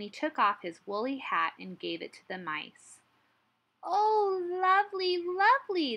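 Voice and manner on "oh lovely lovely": high, loud squeaky coo; delighted mouse squeak. A narrator's reading. Mice thanking Hedgehog for a woolly hat, squeaking. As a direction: squeal it like happy little mice. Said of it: "they squeaked".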